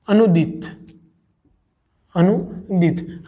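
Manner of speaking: अनुदित said very slowly, syllable by syllable; slow correct word. Shown as a demonstration. Teacher to exam students dictating अनुदित slowly. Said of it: "अनुदित होता है".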